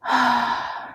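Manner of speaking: long, weary exhale; heavy sigh. Real, not acted. Worker recalling belittling words, heavy sigh. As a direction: breathe out the hurt slowly. After recalling the insult, sighs.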